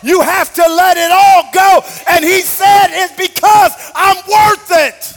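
preaching at full force. Acted. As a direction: drive the sermon home to the crowd. Preacher climbing to shout.

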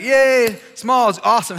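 high, loud mock cheer; acted cheering. Teammates welcoming boy who brought ball, cheering. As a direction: cheer like an excited gang of boys.

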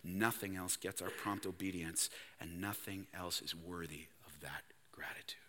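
soft unvoiced whisper; whispered devotion. Real, not acted. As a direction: hush it reverently. Closing prayer-like whisper.

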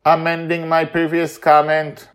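loud, formal correction; loud watcher. Acted. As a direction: boom the correction from above. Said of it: "Mastermind's loud voice reaches our ears".

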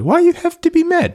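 squeaky high quote of the goalie; Bryzgalov impression. Host quoting the crazy Russian goalie in a high voice. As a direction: pitch it way up like the zany goalie. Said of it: "who's the crazy Russian goalie".

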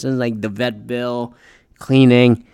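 usual speaking voice

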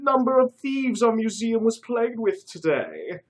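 high hammed-up British curator voice; fake British accent. A narrator's reading. Curator Curtis introducing himself to the heroes, fake British accent. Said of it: "obviously fake British accent".

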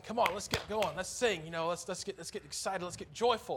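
imitation of a leader rallying the crowd; imitated pump-up. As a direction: egg the congregation on, mock-eager. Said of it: "like, pumped up? They're like, they're saying".

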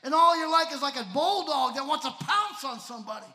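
loud, high preacher's shout; fiery preaching. Acted. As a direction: preach it hard and loud to the crowd. Preaching at full force.

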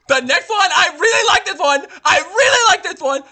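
high, very loud yell over hecklers; shrill-pitched, forceful enthusiastic holler; insistent shouting. Pretend. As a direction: drown out the interruptions, pitch way up. Shouts over the others' mockery to continue.

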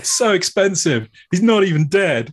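a touch of a whiny pop star; mock band member. Mocking the film's pop star over hologram cost, lightly voiced. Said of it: "It's like".

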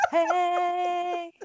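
high, long held-out hey; jazz hands hello. Shown as a demonstration. Demonstrating a jazz-hands hello, showy drawn-out greeting. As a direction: stretch the greeting on one high note. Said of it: "go with the jazz hands hello".

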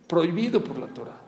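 ordinary delivery

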